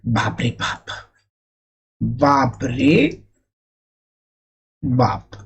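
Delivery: low, soft, slow "बाप रे बाप"; groaning as if hit; mock pain cry. Shown as a demonstration. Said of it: "कोई जोर से मारेगा तो क्या बोलेंगे". (दर्द में कराहते हुए)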